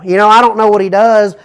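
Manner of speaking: normal talking voice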